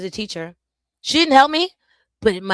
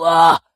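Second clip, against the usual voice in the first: frustrated loud ah; 13 dB louder than usual. Real, not acted. Frustrated outburst.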